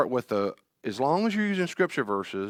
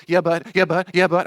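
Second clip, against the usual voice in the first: higher, louder whiny yeah-buts; a panicky kid who keeps interrupting; child protesting over and over; worked-up inner child; 8 semitones higher, 7 dB louder than usual. Retold or imitated. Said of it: "he's really worked up and he's going".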